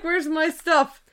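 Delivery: slow, lightly put-on Russian accent; a hint of a thick Russian accent, demanding; mock Russian accent. Angry cashier demanding his stuff, light mock Russian accent. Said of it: "Russian accent".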